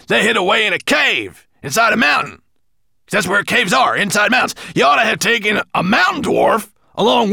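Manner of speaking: high, loud squeaky dwarf voice; a little dwarf gabbling with self-importance; excited dwarf. Acted. Dwarf voice reporting to dragon.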